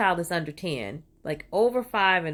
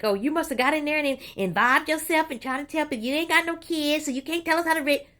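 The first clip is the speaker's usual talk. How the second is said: imagined critic; 7 semitones higher than usual. Retold or imitated. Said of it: "you probably think".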